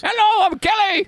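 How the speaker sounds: high squeaky Australian attempt; a weak try at Aussie; Australian accent. Shown as a demonstration. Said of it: "try an Australian voice".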